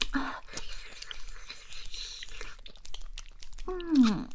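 small panting moans; moaning. Acted. (喘息呻吟)

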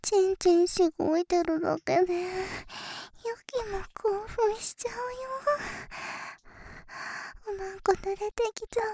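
high, loud breathy cutesy moans; cutesy moe moaning. Pretend. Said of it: "萌え声で、耳元であえいであげます".

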